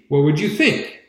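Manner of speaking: ordinary delivery, nothing marked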